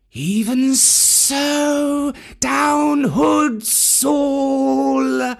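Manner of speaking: very slow, drawn-out serpent voices; cobras answering. A narrator's reading. Cobras answering Mowgli's call, very slow hiss. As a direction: stretch each word like a hissing cobra. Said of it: "said half a dozen low voices".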